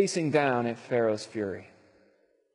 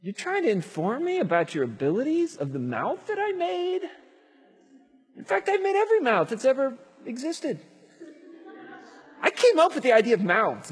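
incredulous God; 10 semitones higher than usual. Acted. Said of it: "God says".

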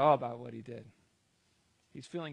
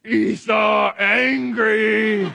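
very slow, loud, hammed-up rage; high, drawn-out caveman-like shout; angry roar; 6 semitones higher, 16 dB louder, much slower than usual. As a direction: bellow it in mock fury. Preacher roars as furious Esau.

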